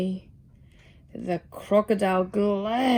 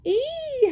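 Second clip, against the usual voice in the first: high yelp of pain; a sudden high howl; 14 semitones higher than usual. A narrator's reading. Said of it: "howled Mister Magee".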